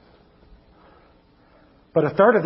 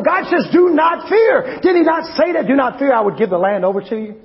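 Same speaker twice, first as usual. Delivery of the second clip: fiery preaching; 6 semitones higher than usual. Acted. Preacher rousing the congregation against fear, fiery shouting sermon. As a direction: preach it out high and hard to the room.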